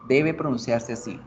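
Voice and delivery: normal talking voice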